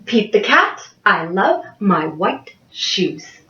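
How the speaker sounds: ordinary delivery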